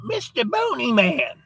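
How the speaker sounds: put-on high, drawn-out child's call; little girl. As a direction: call up to a grown-up in a little girl's voice. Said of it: "this little girl comes up to him".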